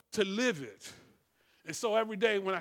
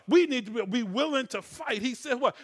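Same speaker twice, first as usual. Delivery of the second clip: ordinary talk, nothing marked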